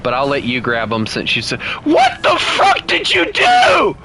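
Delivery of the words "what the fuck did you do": very high, drawn-out shocked scream; real scream. Real, not acted. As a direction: shriek it in shock at what just happened. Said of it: "The scream that came from me".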